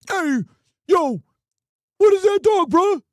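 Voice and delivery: real shout across the room at the dog; hollering at the pet, loud and high; yelling at the dog. Real, not acted.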